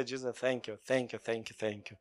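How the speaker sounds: soft, low murmured thanks; whispered-down gratitude to Jesus, fading; soft prayer. Shown as a demonstration. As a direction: sink into a soft, hushed prayer. Demonstrating a quiet prayer.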